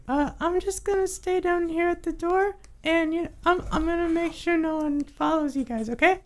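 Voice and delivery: very high, timid little helper; high squeaky sidekick voice. Acted. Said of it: "Buddy is just like".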